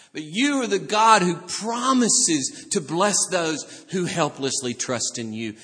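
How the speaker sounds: loud, slow proclamation, then easing; impassioned preaching. Real, not acted. Preacher declaring God's promise to the helpless, fervent proclamation. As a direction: proclaim it with fervour, stretching key words.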